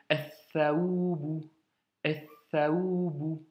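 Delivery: "athaoubu" slow, L silent, doubled th; drawn out "athaoubu", th doubled; silent lam. Shown as a demonstration. Teacher models "athaoubu" slowly, L swallowed. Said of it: "on n'entend pas le lam".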